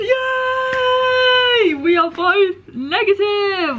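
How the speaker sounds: joyful high-pitched yelling; excited shout. Real, not acted. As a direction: shout for joy at good news. Celebrating negative covid test.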